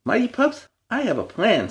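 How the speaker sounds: high rallying call to the team; excited call. A narrator's reading. Chase calls to the Mighty Pups, high excited rally. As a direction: call the pups with sudden hope. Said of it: "said Chase".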